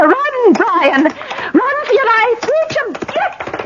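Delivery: high, urgent, fading warning; frightened warning. Acted. Lady warning Brian to flee, high frightened pleading. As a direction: beg him to run for his life.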